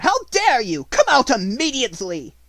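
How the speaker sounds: outrage spilling over; furious shout. A narrator's reading. Headmistress ordering the maid out from under the table, high and furious. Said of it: "she cried out".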